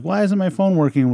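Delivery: a hint of a puzzled, complaining mother; complaining mom. Son imitates mom's constant phone question, mildly exasperated.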